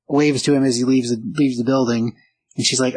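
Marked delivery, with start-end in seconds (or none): none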